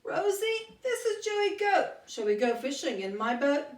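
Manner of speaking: high, eager goat voice on the phone; Joey Goat. Acted. Goat calls Rosie on the phone, high and cheerful. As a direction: play a cheerful goat calling his friend.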